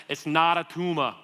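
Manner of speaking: loud mock Austrian accent; movie catchphrase in a thick Austrian accent; Arnold Schwarzenegger. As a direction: do a hammy Austrian action star. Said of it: "think about some of the movies he's been in".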